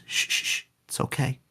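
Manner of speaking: hushed, soft shushing, barely voiced; gentle hushing. Verso hushing Maelle as she dies, soft whisper. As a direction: soothe her quietly as she dies. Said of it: "and he goes".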